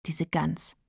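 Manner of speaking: soft, muted inner grumble; private thought. A narrator's reading. Eva thinks about Carola, muttered inner voice. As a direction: think it to yourself, scornfully. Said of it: "dachte Eva".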